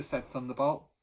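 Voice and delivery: ordinary delivery, nothing marked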